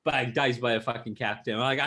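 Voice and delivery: usual speaking voice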